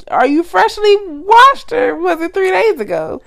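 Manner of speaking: high, slow disbelieving question; incredulous. Real, not acted. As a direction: spell it out in disbelief. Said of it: "Your sentence isn't making sense".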